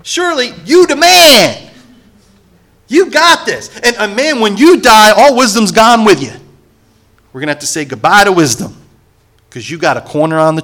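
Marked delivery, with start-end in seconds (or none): 0.0-6.3 high, loud exaggerated mock flattery; sarcastic. Preacher retelling Job's taunt to a friend, high sarcastic mockery. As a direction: lay the sarcasm on thick, sneering praise. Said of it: "I'm gonna put it in the singular for you".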